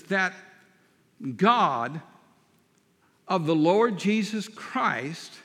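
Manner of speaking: slow, deliberate scripture reading with long pauses. Real, not acted. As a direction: read it out phrase by phrase, weighing each. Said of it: "go through this very slowly".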